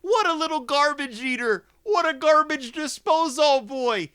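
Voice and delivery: high, loud mock outburst; worked up. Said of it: "I'm getting really worked up".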